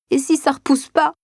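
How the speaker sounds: put-on high tearful little boy voice; a scared child asking through sobs; sobbing child. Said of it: "sanglote l'enfant".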